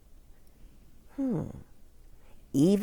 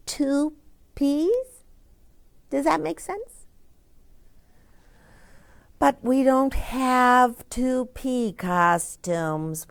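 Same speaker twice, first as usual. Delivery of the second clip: plain reading voice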